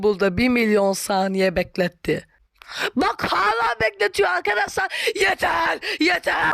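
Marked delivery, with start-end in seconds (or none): 3.0-6.5 exasperated cry. Real, not acted. Ad keeps him waiting.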